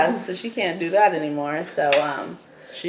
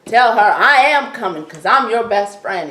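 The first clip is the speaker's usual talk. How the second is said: ordinary delivery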